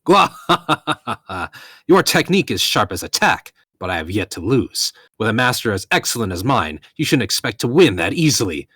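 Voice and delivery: loud, high boastful hero with a hearty laugh; beaming, fearless boast. Acted. Fighter showing no fear to his foe, beaming boast. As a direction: crow proudly at the enemy, laughing first. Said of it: "Gabiru beamed, showing no signs of fear".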